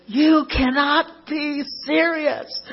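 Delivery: high, slow, drawn-out mock outraged yell; McEnroe yell. McEnroe yelling at the chair umpire, mock outrage. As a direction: belt it out like a furious tennis star. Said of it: "like, yells, he goes".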